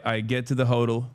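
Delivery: ordinary talk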